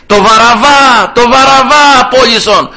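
high, drawn-out mob cry; crowd shouting. Acted. Crowd demands Barabbas from Pilate, shouting. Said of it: "όλους αυτούς εκεί που φωνάζανε".

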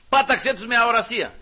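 Πάταξε cried high and loud; fervent prayer. Shown as a demonstration. Elisha praying against the soldiers, loud. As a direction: call down the blindness with force. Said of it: "same words repeated".

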